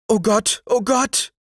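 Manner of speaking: high-pitched cry of dismay; distressed high exclamation; maid crying out. Acted. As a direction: call out in alarm as the maid. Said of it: "rief Rixer, die Magd".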